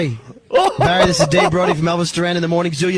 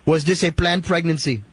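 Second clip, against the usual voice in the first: a light, hammed-up Indian accent; put-on Indian accent. Prank caller quizzing the Irishman, in a light mock Indian accent. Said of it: "stupid of an accent".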